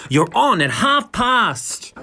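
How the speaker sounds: brisk, bright stage cue; Miss Razzle. A narrator's reading. Club host telling the divas their slot, lightly high. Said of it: "says Miss Razzle".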